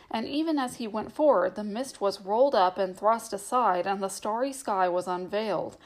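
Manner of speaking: plain reading voice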